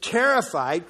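normal talking voice

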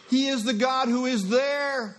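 ordinary talk

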